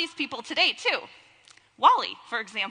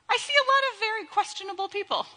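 playful mock accusation; 9 semitones higher, faster than usual. Teasing the congregation.

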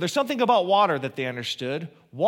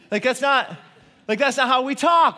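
exasperated outburst; 6 semitones higher, 5 dB louder than usual. Real, not acted. Mocking odd translation.

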